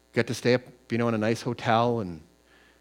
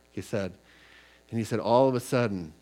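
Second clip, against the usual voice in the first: ordinary talk, nothing marked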